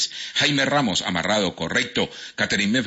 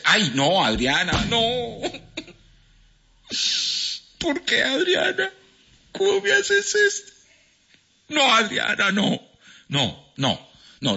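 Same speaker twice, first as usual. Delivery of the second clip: hammed-up wail, pitched far higher; mock despair; 14 semitones higher, slower than usual. Host loses the game to Adriana, hammed-up lament. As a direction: wail it like a betrayed soap hero.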